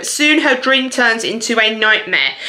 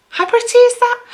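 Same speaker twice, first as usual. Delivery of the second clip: squealing gush; 13 semitones higher than usual. Real, not acted. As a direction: swoon over the cover, pitch way up. Repeats gush, much higher.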